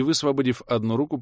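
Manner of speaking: usual speaking voice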